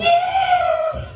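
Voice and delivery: very high long falsetto squeal; imitated cab screech. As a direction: mimic tyres screeching with your voice.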